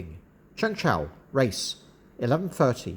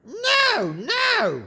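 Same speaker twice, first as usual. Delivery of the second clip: high-pitched parrot echo; high parrot voice, mimicking a refusal; squawking parrot; 8 semitones higher than usual.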